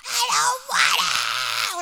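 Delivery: slow, drawn-out tantrum yell; loud, high, wailing mock tantrum; yelling child. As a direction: imitate a four-year-old screaming in protest. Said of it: "like, yell".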